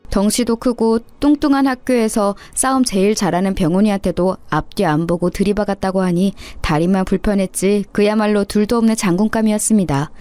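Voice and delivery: plain reading voice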